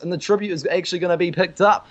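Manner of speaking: normal talking voice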